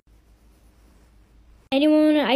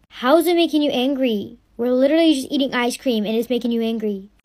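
usual speaking voice

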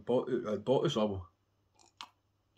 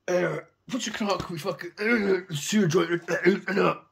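slow, higher, louder wasted slur; slurring high; 8 semitones higher, 9 dB louder, slower than usual. Retold or imitated. Fan high on MDMA asking about the band, slow slurred voice. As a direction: stumble over the words, out of it. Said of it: "I was out my coop in on M D M A".

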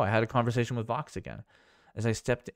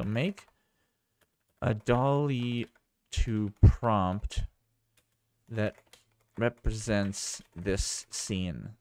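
words spaced out as if typing; slow dictation; much slower than usual. Real, not acted. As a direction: dictate slowly, word by word. Dictating a prompt while typing.

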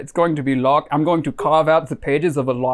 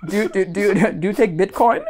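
ordinary talk

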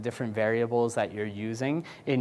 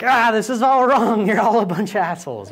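higher, louder mock outrage, laughing; mock disgusted coders; 8 semitones higher, 7 dB louder than usual. Coders fuming at the handed-off designs, loud mock outrage. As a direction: scoff at the designs.

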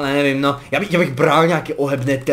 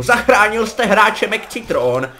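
slow, higher, louder announcer read; game announcer voice; 6 semitones higher, 4 dB louder, slower than usual. Pretend. Gamer mimics a Counter-Strike announcer, slow and higher. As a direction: read it out like a shooter game's system voice. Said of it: "nenapíše tak krásně jako v Counter-Strikeu".

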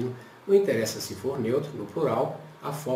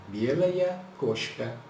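slow model phrase; much slower than usual. Shown as a demonstration. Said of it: "Então eu vou colocar aquela terminação".

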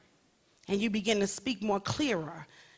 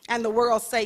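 usual speaking voice

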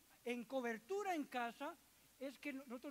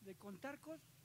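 quiet, almost murmured listing of counts; quoting Rafita softly; 8 dB quieter than usual. Retold or imitated. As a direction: hush it like he counts to himself. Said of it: "Y él dice". (citando a Rafita en voz baja, contando para sí)

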